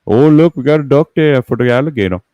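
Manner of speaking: slightly high Cajun exclamation; Cajun impression. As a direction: point it out like a gator hunter. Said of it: "Toy Landry impression".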